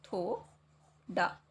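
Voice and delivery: थोड़ा drawn out slowly; teacher dictating थोड़ा slowly; slow dictation. Shown as a demonstration. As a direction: stretch थोड़ा out for the class. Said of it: "आपका कौन सा है?".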